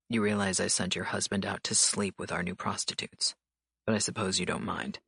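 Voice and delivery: cool authority, unhurried; stern mafia boss. A narrator's reading. Dante voiced by narrator.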